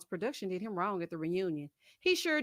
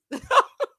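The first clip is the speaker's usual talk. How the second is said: high quick laugh; short burst of laughter; 10 dB louder than usual. Real, not acted. Laughs at own joke.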